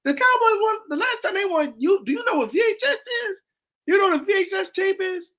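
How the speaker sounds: very high, incredulous questioning; worked-up rant. Retold or imitated. Uncle quizzing his nephew on the Cowboys' past, worked-up. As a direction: squeal the questions in mock outrage.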